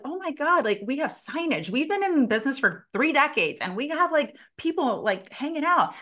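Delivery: high-pitched, mock-amazed rush of excitement; excited clients. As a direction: imitate thrilled owners gushing. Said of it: "They're excited".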